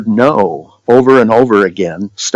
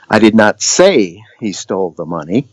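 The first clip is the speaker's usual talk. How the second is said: "say" stretched and emphasized, rest lighter; stress on SAY. Shown as a demonstration. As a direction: lean hard on the word "say". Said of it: "the different emphasis".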